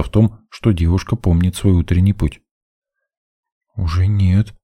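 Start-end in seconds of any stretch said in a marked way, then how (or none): 3.8-4.5 put-on slightly strained girl's answer; tense reply. A narrator's reading. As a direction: answer with a touch of tension. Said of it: "с напряжением в голосе откликнулась". (с лёгким напряжением в голосе)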